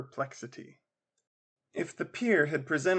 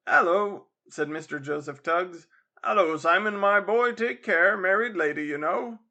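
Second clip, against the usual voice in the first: loud, higher, knowing chuckle in the voice; jovial teasing; 4 semitones higher, 5 dB louder than usual. Acted. Father warns Simon off the married lady, loud and jovial. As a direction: tease the boy with a wink. Father teases loudly, winking.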